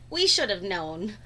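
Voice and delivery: plain reading voice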